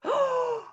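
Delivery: high mock-horror gasp; sharp theatrical gasp; horrified gasp. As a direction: gasp in storybook shock. Horrified reaction to the picture.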